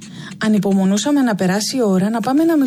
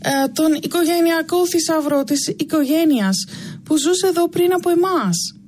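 put-on higher, slow, whiny child's voice; whining boy; 6 semitones higher than usual. A narrator's reading. Adam whines as his mother pulls him by the ear. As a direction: whimper it out while being dragged away. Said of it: "συνέχισε ο Άνταμ κλαψουρίζοντας".

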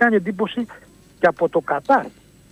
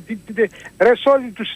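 usual speaking voice

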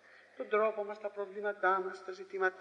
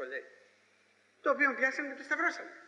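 normal talking voice

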